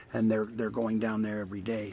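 ordinary talk

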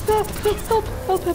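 high, loud, rapid cries for help; playful high-pitched panic; panicked cry for help. Pretend. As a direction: yell for help in mock panic. Calls for help as game threatens.

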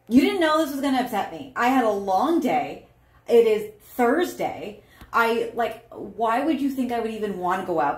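furious partner ranting about a long day; angry outburst. Acted. Acted angry partner in demo.

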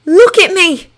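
high, loud commanding shout. A narrator's reading. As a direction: shout to snap him out of it. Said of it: "and shouted".

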